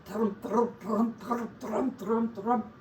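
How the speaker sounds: steady chanted thrum; rhythmic hoofbeats. A narrator's reading. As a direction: drum out the galloping feet. Sound of galloping piglets.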